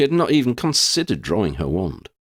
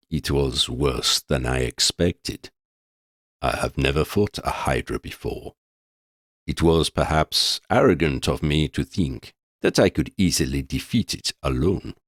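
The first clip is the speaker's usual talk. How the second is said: deep sepulchral voice; 6 semitones lower than usual. A narrator's reading. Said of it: "deep sepulchral voice".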